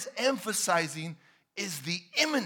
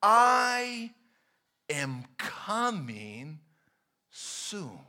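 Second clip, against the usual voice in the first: solemn drawn-out declaration; solemn slow promise; 4 dB louder, much slower than usual. Acted. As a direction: weigh each word of the promise. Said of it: "He says".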